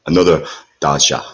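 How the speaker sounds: put-on light Russian accent on Dasha. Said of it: "Did I do it in my good Russian accent?".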